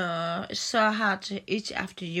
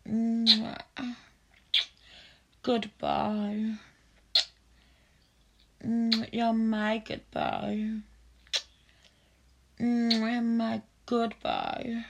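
slow tender praise; slower than usual. Acted. Tender closing praise, repeated.

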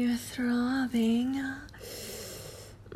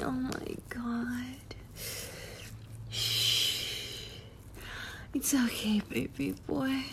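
slow, breathy intimate whisper, softer; ASMR whisper; 7 dB quieter than usual. Acted. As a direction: lean in close and whisper it. Erotic roleplay, whispered intimacy.

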